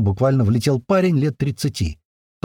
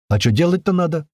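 higher, puzzled question; dumbfounded; 7 semitones higher than usual. A narrator's reading. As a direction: ask it taken aback, pitch raised. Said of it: "обалдели мы".

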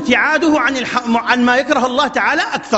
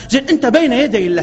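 ordinary delivery

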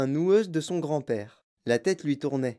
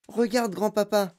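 put-on high-pitched boy's exclamation; Charlie exclaiming; 6 semitones higher than usual. A narrator's reading. Boy pointing something out to grandpa, exclaiming. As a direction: call out in discovery, pitched up. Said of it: "s'écria Charlie".

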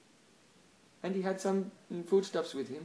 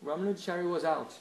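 ordinary talk

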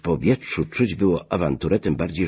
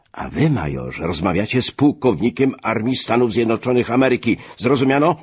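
raised voice building to a high, loud demand; commanding outburst, higher and louder at the end; indignant exclamation; 6 semitones higher, 3 dB louder than usual. A narrator's reading. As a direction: pull rank, climbing to a sharp final question. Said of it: "wykrzyknął Grow".

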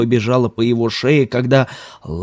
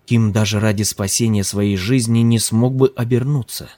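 usual speaking voice

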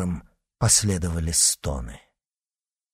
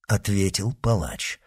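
usual speaking voice

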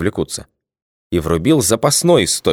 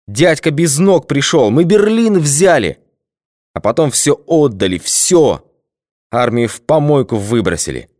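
put-on drunk bitter rant; 5 semitones higher than usual. A narrator's reading. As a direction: pour out drunken despair. Drunk colonel rants bitterly.

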